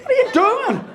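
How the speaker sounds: high, squeaky disbelief; incredulous. Retold or imitated. Husband baffled at his wife putting, high and disbelieving. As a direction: shriek it in disbelief.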